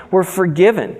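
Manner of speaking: ordinary talk, nothing marked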